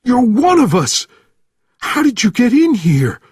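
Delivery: high, loud frantic questioning; panicked shouting. A narrator's reading. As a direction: demand answers at a scream.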